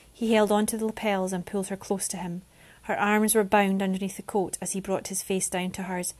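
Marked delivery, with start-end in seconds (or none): none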